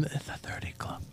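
hushed whisper, no voice. Real, not acted. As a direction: whisper it like a secret. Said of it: "Why are you whispering?".

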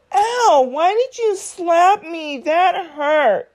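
high, hurt cry after a slap; just got slapped. Pretend. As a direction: wince and whine like you've been hit. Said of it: "You're gonna go".

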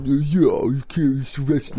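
attempted Stallone. Shown as a demonstration. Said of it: "I can't really do it".

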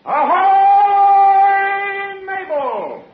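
long, high, loud sailor's hail; calling out. Acted. Sailor home from sea calls for his wife, long hail. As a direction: holler it as if across the deck.

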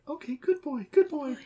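high cooing praise; pet praise. Acted. Owner praising Buddy after he lets go, high cooing.

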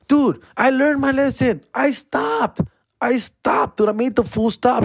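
high, insistent mock protest; pleading denial. Driver insisting to the cop he stopped, pleading denial.

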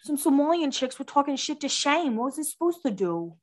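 overdone mock Boston accent; Blake Lively. Host quoting The Town to mock the accent, put-on Boston accent. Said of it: "Blake Lively's accent in The Town".

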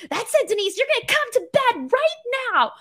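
high, strained imitation of a mom ordering her kid to bed; hammed-up furious mom putting her foot down; angry mom. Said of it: "She would get really angry".

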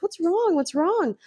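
high, lightly voiced concern; mock concerned friend. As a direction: rush in asking sweetly. Girl runs to comfort her.

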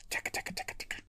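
rapid whispered key-clicking sounds; soft, voiceless tick-tick typing imitation; imitated typing noise. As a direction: mimic keyboard clatter under your breath. Imitating June typing on a keyboard.

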